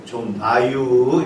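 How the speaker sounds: very slow, drawn-out Chungcheong dialect. Shown as a demonstration. 충청도 사투리로 아주 느리게. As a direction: stretch it like a Chungcheong speaker. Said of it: "충청도에서는".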